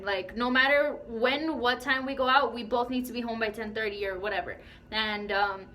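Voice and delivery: ordinary delivery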